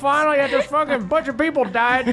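high, bitter granny rant; swearing tirade. Acted. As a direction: spit out the curses like an old granny. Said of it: "Try to keep it a bit clean".

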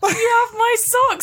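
mock wail. Friend reacting to the sock story, mock wailing. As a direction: howl the line in fake anguish.

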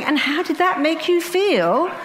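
high, laughing parody of therapist question; mock the stock therapist line while laughing; mocking psychiatrist. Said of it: "So I just keep saying".

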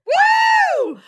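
very high, drawn-out "woo" whoop; whooping cheer. Real, not acted. Co-host cheering the last reading, high whoop.

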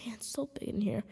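hushed whisper aside. Acted. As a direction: whisper it to the listeners. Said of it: "audio sounds a little bit echoey".

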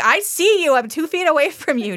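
indignant retort. Retold or imitated. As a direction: call him out in a hammed-up quarrel voice. Retold quarrel, girlfriend catches him.

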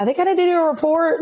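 high-pitched, unsure mock panic; flustered rookie. Rookie officer with no clue at the scene, flustered. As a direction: pitch climbing, out of your depth.

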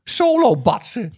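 high, slow neighbour voice; Jacques de Vries impression. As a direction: play the nosy neighbour, high. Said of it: "Maar dat is Jack de Vries".

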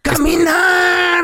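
high, long drawn-out cry; big announcer-style holler; triumphant reveal. Acted. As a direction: shout it out as the grand reveal, stretched. Reveals the magic remedy.

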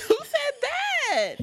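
very high, drawn-out echo; mock incredulous. Friend echoing the man's balk at marriage, mock incredulous. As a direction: stretch it slowly into a comic high squeal.

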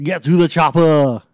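put-on loud, high Austrian Arnold accent; Arnold impression. Mocking Arnold to a podcast co-host, loud Austrian accent. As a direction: bark it like Schwarzenegger. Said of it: "type of thing".

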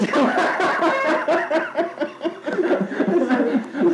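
long high laugh. Real, not acted. Laughing at own war story.

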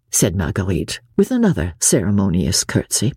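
usual speaking voice

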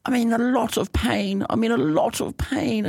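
put-on high, thin old lady voice, slow and pained. Old neighbour phoning Jen, high and pained. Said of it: "She goes".

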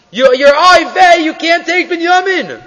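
hammed-up oy vey lament; mock fretting. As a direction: mimic the brothers' fussing. Yosef mocking brothers' worry.

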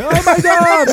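high, hammed-up shocked "oh my God"; mock shocked gasp. Retelling a Springer guest's shock, mock shocked high gasp.

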